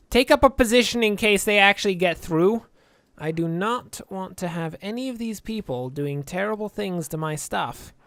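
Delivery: ordinary talk, nothing marked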